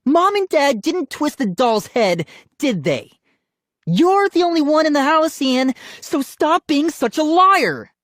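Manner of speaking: high, loud angry accusation; crying out. A narrator's reading. Sister calling brother a liar, crying out. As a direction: yell accusingly, building to the last word. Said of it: "Molly cried".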